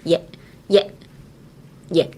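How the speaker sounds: "Yet" with a held, unreleased T; silent T drill. Shown as a demonstration. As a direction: stop the T in "yet" without releasing it. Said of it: "ちっちゃいつですね".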